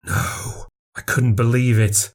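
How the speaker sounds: ordinary delivery, nothing marked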